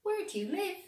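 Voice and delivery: high cat question; the cat asking the dog kindly. A narrator's reading. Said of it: "said the cat".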